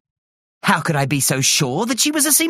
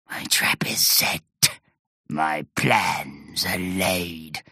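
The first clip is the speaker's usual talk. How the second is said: low, slow hushed scheming; sinister whisper; 11 semitones lower, 5 dB quieter than usual. A narrator's reading. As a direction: whisper the plot to yourself.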